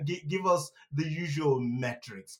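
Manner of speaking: usual speaking voice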